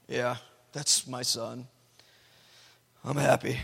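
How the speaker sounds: put-on a low, slow, unimpressed mutter of an old man; grumpy grandpa. Imitating God as a grumpy grandpa, low and slow. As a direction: shrug it off like a bored grandpa, lightly voiced. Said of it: "portrayed as this grumpy grandpa".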